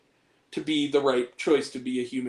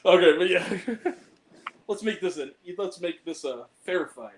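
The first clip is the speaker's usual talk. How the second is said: laughing; 4 semitones higher than usual. Real, not acted. Laughing wrap-up.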